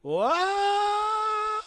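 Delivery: very high, loud shocked scream; top of lungs yell. Acted. Narrator shocked by Satan's warning, full yell. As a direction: scream it in disbelief. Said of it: "I yelled at the top of my lungs".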